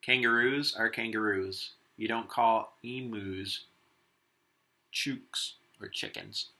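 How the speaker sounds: lively picture-book read-aloud as Warren the wombat; reading to children. Acted. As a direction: read it to the class with a touch of Aussie flair. Continues reading the story.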